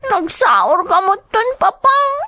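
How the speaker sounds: put-on a little girl hurt that papa is leaving; upset child. Child protests father leaving her behind.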